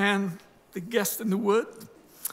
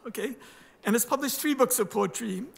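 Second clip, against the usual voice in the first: normal talking voice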